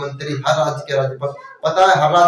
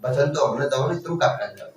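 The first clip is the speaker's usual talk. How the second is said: lower, softer pleading as King Dasharatha; pleading father; 4 semitones lower, 6 dB quieter than usual. Retold or imitated. Dasharatha begging Ram not to go to the forest, low and soft. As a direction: beg him quietly not to leave. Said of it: "वन के बाप ने सुद्धा वन रोके".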